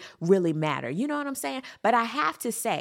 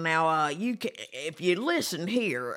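mock senator voice; 4 semitones lower than usual. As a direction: talk down like a patronizing senator. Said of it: "basically saying".

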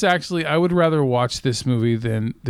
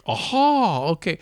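realizing aha; 4 semitones higher, slower than usual. Retold or imitated. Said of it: "then it was like".

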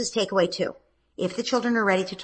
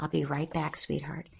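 much softer, low murmured aside; beneath my breath; 3 semitones lower, 8 dB quieter than usual. Shown as a demonstration. Mother slipping away from her son, softly under her breath. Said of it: "almost beneath my breath".

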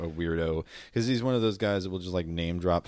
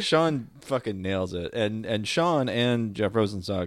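usual speaking voice

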